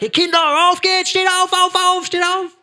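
put-on high, loud shout at the kids; mock yelling mother. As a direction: yell the children up for school. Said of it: "Du kommst nicht her und sagst".

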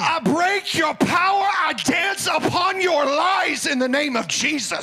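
high, shouted commands to devils; shouting deliverance. Real, not acted.

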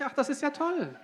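high feigned wonder; mock amazement. Interpreter echoes the preacher's mock wonder, high. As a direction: play innocent and impressed. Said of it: "Und dann sage ich".

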